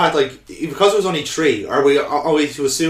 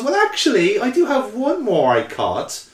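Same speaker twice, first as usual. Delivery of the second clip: high-pitched, hammed-up reveal; coy professor; 10 semitones higher than usual. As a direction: draw it out in a high, teasing voice.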